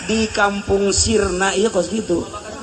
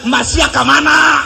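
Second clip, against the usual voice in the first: mock rough call; 7 semitones higher, 4 dB louder than usual. Mock harsh call to husband.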